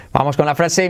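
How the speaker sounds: ordinary delivery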